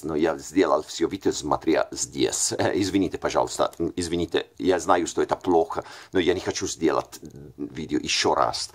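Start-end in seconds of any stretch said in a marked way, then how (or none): none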